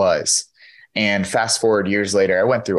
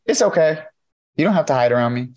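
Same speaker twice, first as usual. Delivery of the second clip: put-on higher, gentle child's voice saying it's okay; little girl's reassurance; 7 semitones higher than usual. A young girl comforting the speaker, lightly voiced child. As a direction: voice the nine-year-old kindly.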